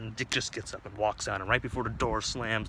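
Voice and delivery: normal talking voice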